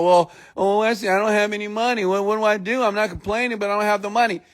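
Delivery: high, rushed plea, lightly mocking an excuse-maker; mock pleading, pitched right up; mock complainer. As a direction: imitate someone pleading poverty in a hurry.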